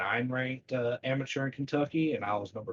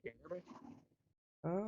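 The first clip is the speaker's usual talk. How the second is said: muffled, as if mic is covered; speaking through a covered microphone; 8 semitones higher, 10 dB quieter, much slower than usual. Real, not acted. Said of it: "still muffled a little bit".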